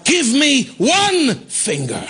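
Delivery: slow, high, drawn-out proclamation; emphatic preaching. Retold or imitated. Preacher quoting the woman's faith to the congregation, emphatic. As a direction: proclaim it slowly, stretching each word.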